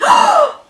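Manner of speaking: sharp, high horrified gasp; loud shocked intake of breath. Acted. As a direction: gasp in alarm. Parents shocked by question.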